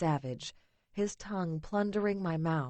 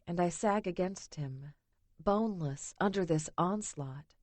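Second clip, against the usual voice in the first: ordinary delivery, nothing marked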